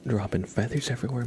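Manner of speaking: ordinary talk, nothing marked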